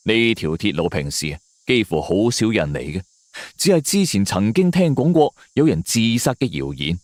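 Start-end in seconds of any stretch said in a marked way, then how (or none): none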